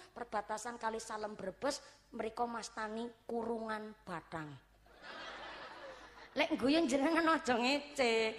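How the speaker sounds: ordinary delivery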